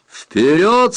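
high, loud, rising order; shouted command. A narrator's reading. Leader orders men on through the tunnel, commanding. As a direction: send the word upward as a charge order. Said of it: "скомандовал Камамури".

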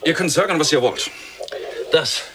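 high, loud insistence; agitated announcement. Acted. Man tells the crew of his find, agitated.

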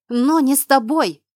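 loud, high snapped retort; angry bark. A narrator's reading. Friend refuses to accept it for her, barking. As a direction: bark it out in anger. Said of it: "рявкнула я".

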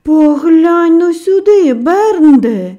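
amazed cry. A narrator's reading. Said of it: "вражено скрикнув".

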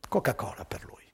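awed hush; soft unvoiced whisper of wonder. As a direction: breathe it out, amazed. Narrator marvels at drinker.

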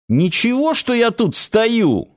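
high-pitched, stung sarcasm; sarcastic. A narrator's reading. Alexei, feeling ignored by his companions, sarcastic. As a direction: needle them, voice pitched well up. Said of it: "съязвил Алексей".

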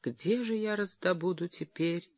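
soft lament fading into a whisper; understated weeping, voice dying away at the end; mildly tearful. A narrator's reading. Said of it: "она разрыдалась".

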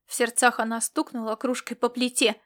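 ordinary delivery, nothing marked

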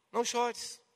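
soft, hushed comfort; gentle consolation. Shown as a demonstration. Jesus comforting the grieving widow, gently. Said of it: "mas é um consolo".

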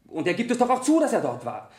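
indignant defence. Acted. Witness insists to the lawyer he is right, indignant. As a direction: push back, exasperated.

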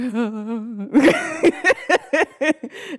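loud, high mock sobbing; imitated wailing cry. Caller crying to her friend on the phone, hammed-up wail. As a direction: wail in exaggerated bursts, half laughing. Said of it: "the first thing she hears is me crying".

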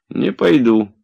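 a flat, dug-in refusal; stubborn. A narrator's reading. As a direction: refuse like a stubborn old servant. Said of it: "упрямо сказал Захар".

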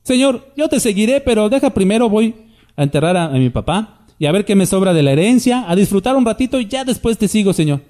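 high, hammed-up pleading of a half-hearted disciple; mock excuse-making follower. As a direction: play the follower who bargains with the Lord. Mocking a reluctant follower.

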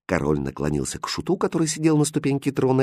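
ordinary delivery

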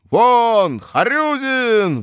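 long loud howled chant; class howls. A narrator's reading. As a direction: howl it as a mob. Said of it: "взвыл класс".

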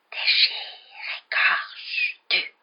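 eerie drawn-out whisper; cold, deadly voice. A narrator's reading. Unseen voice in the passage, deadly whispering. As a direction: whisper each word with cold menace. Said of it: "la même voix froide et mortelle".

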